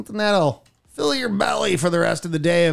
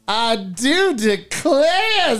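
mock southern belle; 3 semitones higher, much slower than usual.

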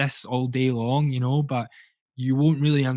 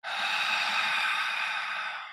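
long breathy sigh out through the mouth; demonstration sigh; 6 dB quieter than usual. Shown as a demonstration. Guide showing the listener how to sigh, audible exhale. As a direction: let the breath fall out in a sigh. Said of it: "Keep breathing".

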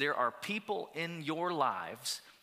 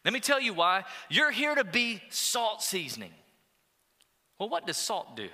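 raised, emphatic call from the pulpit; preacher voicing Jesus, louder and higher; 6 semitones higher, 4 dB louder than usual. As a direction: proclaim it like Jesus speaking. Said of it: "And Jesus says".

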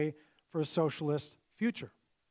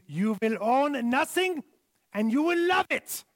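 mock German accent; 7 semitones higher, 4 dB louder than usual. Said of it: "he has a German accent".

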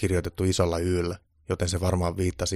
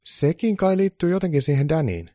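high, loud little-elf voice; high-pitched helper's explanation; 10 semitones higher, 6 dB louder than usual. A narrator's reading. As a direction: pipe up with a guess. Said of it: "Eero sanoi".

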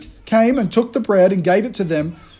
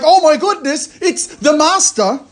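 higher, slow, thrilled exclamation; excited realisation; 7 semitones higher than usual. Retold or imitated. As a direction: let the wonder burst out. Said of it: "they're like".

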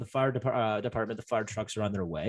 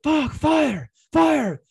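much higher and louder yell of alarm; panicked yell; 14 semitones higher, 7 dB louder, much slower than usual. Retold or imitated. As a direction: shout the alarm up the stairs. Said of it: "I'm, like, yelling to the guys upstairs".

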